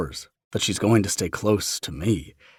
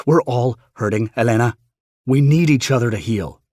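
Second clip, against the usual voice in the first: loud, raised argument; heated plea; 4 semitones higher, 8 dB louder than usual. Retold or imitated. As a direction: push back hard.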